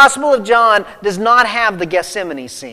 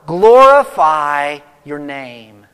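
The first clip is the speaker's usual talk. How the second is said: slow, hushed and reverent; solemn prayer; 4 semitones lower, 5 dB louder, slower than usual. Retold or imitated. Preacher voicing Jesus praying, slow and reverent. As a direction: lay the words down gently like a prayer. Jesus' bold proclamation, quoted slowly.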